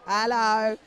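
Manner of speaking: loud playful "hello" as if impressed; cheeky loud mock-impressed hello; hammed-up hello. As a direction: sell it like a cheeky double take. Joking level sixty-six.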